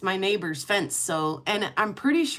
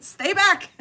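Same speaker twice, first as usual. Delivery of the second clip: mock warning; 10 semitones higher, 4 dB louder than usual. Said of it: "I was like".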